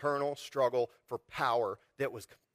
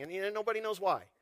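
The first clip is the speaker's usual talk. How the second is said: ordinary talk, nothing marked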